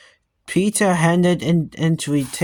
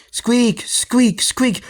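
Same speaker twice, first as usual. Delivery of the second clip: toy squeak; 6 semitones higher, slower than usual. A narrator's reading. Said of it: "squeezing the toy".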